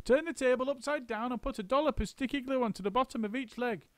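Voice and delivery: high instructing monkey; high brisk monkey foreman; bossy monkey. A narrator's reading. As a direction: plan it out quickly. Mugglewump's orders.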